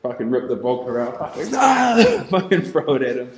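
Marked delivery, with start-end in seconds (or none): 1.2-2.3 high laughing battle cry as he throws; laughing war cry. Retold or imitated. Young man charging a thug hurls a bottle with a laughing high war cry.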